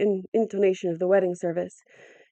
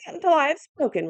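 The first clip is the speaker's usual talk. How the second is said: put-on higher, sharp old man's retort; 8 semitones higher than usual. A narrator's reading. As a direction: snap back defiantly. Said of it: "retorted Igos".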